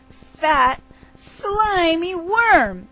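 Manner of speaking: slow, high-pitched drawl on the words; drawn-out relish. A narrator's reading. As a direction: stretch it out with slimy relish. Storyteller relishing a gross description.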